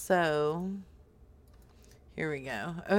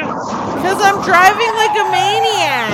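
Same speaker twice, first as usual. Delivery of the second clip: panicked shouting, higher and louder; frantic yelling; 9 semitones higher, 8 dB louder than usual. Acted. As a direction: scream for help while fleeing. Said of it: "so it sounds like you're frantic".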